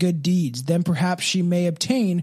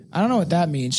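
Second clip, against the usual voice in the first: usual speaking voice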